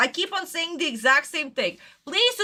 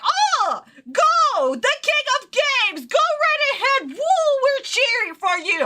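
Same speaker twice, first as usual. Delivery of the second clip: mock cheering; 10 semitones higher, slower than usual. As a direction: cheer like an overeager fan. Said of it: "Hot is like".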